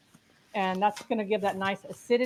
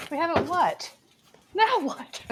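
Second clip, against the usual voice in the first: surprised; 6 semitones higher than usual. Real, not acted. Surprised reaction to teasing.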